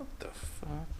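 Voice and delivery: stunned whisper; hushed what the fuck; whispered disbelief. Retold or imitated. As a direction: mouth it in shock. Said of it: "I was like".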